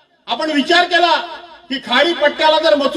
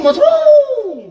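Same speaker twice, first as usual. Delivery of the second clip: much higher, louder mock cry of mushroom; mocking the rival; 12 semitones higher, 4 dB louder, much slower than usual. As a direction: squeal mushroom in a hammed-up mocking voice. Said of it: "प्रत्येक वेळी mushroom". (Mushroom उंच, मोठ्याने चिडवत)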